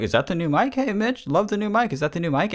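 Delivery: squeaky, excited fan; high mock fan voice. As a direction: gush like an eager fan. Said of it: "so many people were just like".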